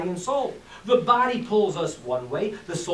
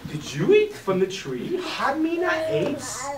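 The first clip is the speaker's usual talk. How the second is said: God asking; 6 semitones higher, slower than usual. Acted. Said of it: "God saying".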